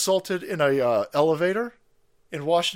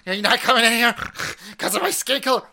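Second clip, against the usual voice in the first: higher, fast mocking voice of an offended stranger; 5 semitones higher, 4 dB louder, faster than usual. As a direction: rattle it off like a stranger taking offence, pitched up.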